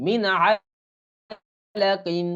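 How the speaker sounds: drawn-out "min alaqin"; clear "min" before ain. Shown as a demonstration. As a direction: blend "min alaqin" with a clean n. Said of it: "এরকম উচ্চারণ হবে". ("মিন আলাকিন" স্পষ্ট টেনে পড়া)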